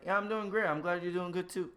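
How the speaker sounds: high, loud automatic pleasantry; oblivious cheerfulness. Acted. Greeter who wasn't listening answers, oblivious loud cheer. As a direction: chirp it back without listening.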